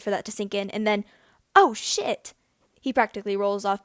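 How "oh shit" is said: sudden high alarmed exclamation, louder; startled curse. A narrator's reading. James realising he overslept, a startled high alarmed curse. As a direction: jolt awake in panic at the hour.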